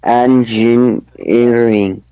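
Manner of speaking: engineering very slowly, part by part; broken into syllables. Shown as a demonstration. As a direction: sound out engineering at half speed in four beats. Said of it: "break it into syllables".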